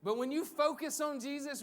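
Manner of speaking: ordinary talk, nothing marked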